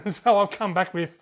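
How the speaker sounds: ordinary delivery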